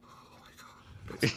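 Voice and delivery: slow breathless whisper; whispered awe. Retold or imitated. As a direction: whisper it, stunned. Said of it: "you're like".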